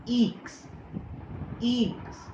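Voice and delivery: ईक्स said as a long "ee" then "ks"; careful French letter name ईक्स. Shown as a demonstration. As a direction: say ईक्स clearly, stretching the "ee". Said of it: "जिसे हम बोलते हैं".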